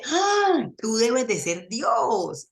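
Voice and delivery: slow, awestruck dog voice; adoring dog. Acted. The dog thanks its feeder in slow, adoring awe. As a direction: gaze up at your master in slow wonder. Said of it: "el perro dice".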